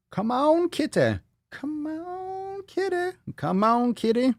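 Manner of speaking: high, sing-song pet call; calling the kitty. Real, not acted. Host coaxing his cat to come, high pet call.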